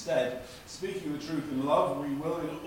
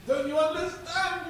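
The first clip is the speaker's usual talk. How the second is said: high, loud frantic plea; 5 dB louder than usual. Acted. Shipwrecked man pleading with calm friend, frantic high voice. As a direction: beg him to see the danger.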